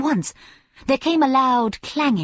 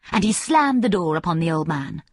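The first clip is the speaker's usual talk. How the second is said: ordinary delivery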